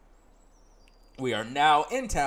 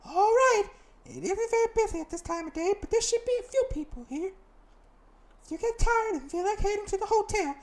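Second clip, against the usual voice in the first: put-on bright girl guide voice, much higher; high companion voice; 3 dB louder than usual. Companion greeting the player in town, high voice. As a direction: play the chirpy companion up high.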